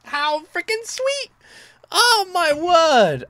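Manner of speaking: very high, loud, thrilled exclamations; ecstatic amazement. Real, not acted. Fan reacting to the video's surprise ending, ecstatic amazement. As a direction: cry out in real delight, stretching the big words.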